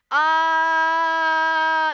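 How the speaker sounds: long, loud held "uh"; objecting birds. Retold or imitated. Birds stop the departing couple, blaring loudly. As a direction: blare one sustained objection.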